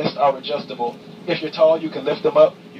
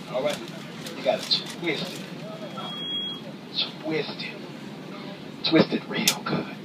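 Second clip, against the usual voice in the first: quiet coaching; 7 dB quieter than usual. Shown as a demonstration. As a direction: hush it down, coax them gently. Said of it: "show you how to close this door quietly".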